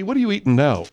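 ordinary talk, nothing marked

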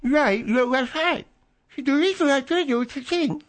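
talking with mouth tightened; garbled through a tightened face; face scrunched while talking. Pretend. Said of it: "No one can understand what you're saying".

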